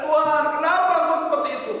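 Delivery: high, loud cry to God; screamed protest. Retold or imitated. Young man questioning God in prayer, screamed protest. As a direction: shout it out in anguished protest. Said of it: "dia teriak".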